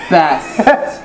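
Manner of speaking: laughing through the word Best. Real, not acted.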